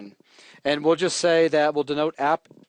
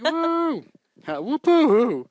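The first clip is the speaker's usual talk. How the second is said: a hammed-up drunken woo-hoo, pitched up; tipsy whoop; 11 semitones higher, slower than usual. Coder voicing a slightly buzzed Nelson, hammed-up whoop. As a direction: whoop like a buzzed buddy at a party. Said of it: "He's like".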